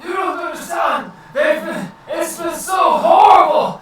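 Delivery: high, loud frantic begging; desperate plea. Acted. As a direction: plead as a tortured prisoner. Prisoner pleading with players.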